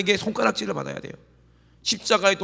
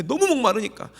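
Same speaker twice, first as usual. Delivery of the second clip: raised emphatic; 7 semitones higher, 4 dB louder than usual. Shown as a demonstration. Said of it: "왜?".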